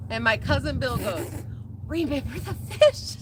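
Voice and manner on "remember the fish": voiced, higher-pitched question; retold sly reminder. Cousin at the funeral reminding her, higher-pitched question. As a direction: ask it aloud, lifted in pitch.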